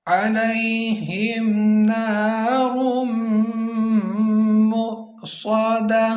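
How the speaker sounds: slow, high melodic tajweed chant; Quran recitation. Shown as a demonstration. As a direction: recite the verse as a drawn-out melody.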